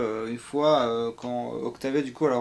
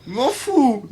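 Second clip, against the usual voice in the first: high, loud imitation breaking into a laugh; Bliss's end intonation; 12 semitones higher, 10 dB louder than usual. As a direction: mimic Bliss's throwaway line, laughing. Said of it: "son intonation de fin". (imitation aiguë et forte de Bliss, en riant)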